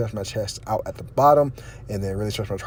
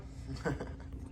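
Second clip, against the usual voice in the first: soft laugh; 4 semitones higher, 15 dB quieter than usual. Real, not acted. Laughing at the cat.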